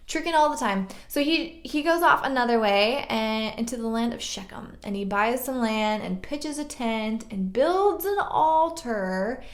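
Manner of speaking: ordinary delivery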